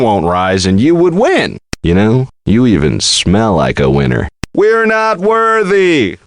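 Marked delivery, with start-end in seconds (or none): 4.5-6.2 high, slow, chanted bowing cry; hammed-up chant of adoration; mock worship. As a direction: grovel theatrically before the champ.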